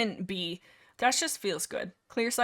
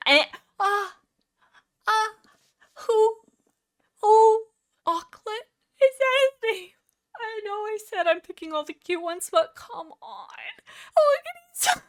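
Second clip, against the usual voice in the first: high, squeaky cooing; stunned, high little squeaks; gushing over cuteness; 11 semitones higher than usual. Real, not acted. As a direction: melt into a high-pitched gush. Overcome by a cute character.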